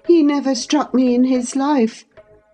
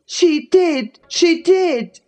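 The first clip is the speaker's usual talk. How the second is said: put-on high, falling boyish chant; singsong taunt; 13 semitones higher, slower than usual. A narrator's reading. Linton enjoys his cousin's agitation, taunting in singsong. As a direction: sing it out to goad her. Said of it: "sang Linton".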